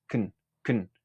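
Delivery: "kn", can with the vowel swallowed; reduced weak form. Shown as a demonstration. Teacher showing the reduced form of can, "kn" without full vowel. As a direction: shrink can to a quick unstressed "kn". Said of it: "se suele decir en su forma reducida".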